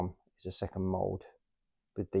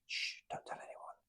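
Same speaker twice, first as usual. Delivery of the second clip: conspiratorial whisper; hushed aside so nobody hears the confession; 15 dB quieter than usual. Real, not acted. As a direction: whisper it like a secret to viewers. Confessing lack of research.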